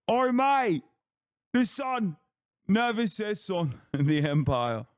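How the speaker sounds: high, drawn-out British caricature; mock British accent. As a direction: wail it in a hammed-up British accent. Playing a British chatter caricature.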